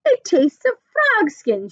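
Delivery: slow, high, gasping disgust; gasped. Acted. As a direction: gasp it out after spitting. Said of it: "she gasped".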